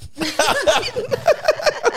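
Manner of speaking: real laugh breaking out high; can't hold the laugh in; helpless laughter; high burst of laughter. Real, not acted. Laughing at the gazpacho story.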